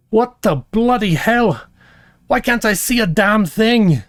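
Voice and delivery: a startled dwarf coming back to life; dwarf woman waking up bewildered; gruff dwarf woman. Acted. Dwarf wakes from apparent death.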